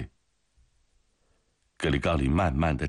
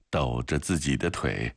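ordinary delivery, nothing marked

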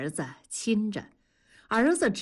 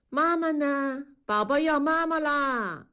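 put-on higher toddler voice calling for mommy; little child crying for mom; 7 semitones higher than usual. A narrator's reading. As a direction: whine like a small child wanting his mother. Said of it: "大宝开始哭着找妈妈".

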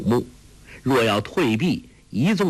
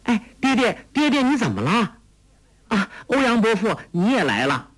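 put-on high, quick girlish cry; urgent high-pitched shout without much volume; high-voiced calling out; 11 semitones higher, faster than usual. A narrator's reading. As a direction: call out excitedly to father and uncle, pitched well up. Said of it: "当即高声叫道".

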